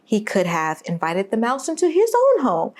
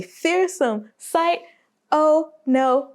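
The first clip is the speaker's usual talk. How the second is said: higher and slow on "a fearsome sight"; drawn-out high refrain; 5 semitones higher, slower than usual. Acted. Repeated refrain, rising intensity.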